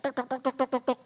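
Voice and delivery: tapping out knocks with the voice; knocking sound. Retold or imitated. Preacher mimics the devil knocking at the heart's door. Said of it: "si iblis ketok".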